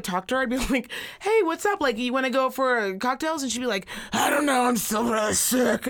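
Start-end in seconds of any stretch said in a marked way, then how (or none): 1.2-3.4 high-pitched, chirpy retold greeting; breezy invite. Friend inviting her out for cocktails, breezy and high. 4.1-5.9 mock sick voice. Sick friend turning down cocktails, exaggerated ailing voice. Mimics friend's complaint of lasting illness.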